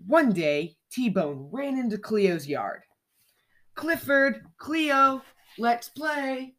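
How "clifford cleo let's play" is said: high calling shout; mild storybook shout. A narrator's reading. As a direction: call out to friends across the yard. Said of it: "he shouted".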